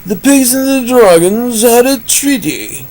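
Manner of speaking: high put-on narrator voice; different voice. Shown as a demonstration. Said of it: "How about a different voice?".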